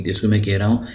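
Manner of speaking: normal talking voice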